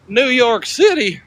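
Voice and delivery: high, very slow, appalled cowboy; salsa ad cowboy. Retold or imitated. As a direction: stretch it out like the outraged ad cowboy. Quoting the picante sauce ad again.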